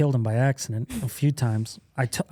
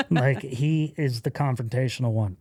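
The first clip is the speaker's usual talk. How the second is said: ordinary delivery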